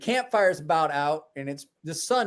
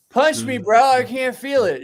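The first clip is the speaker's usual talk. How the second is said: put-on drunk eager request; 7 semitones higher, 7 dB louder than usual. Imitating drunk kid.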